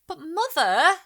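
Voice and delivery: put-on high, loud child's protest. A narrator's reading. Girl objecting to mother, high and loud. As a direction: cut in to object.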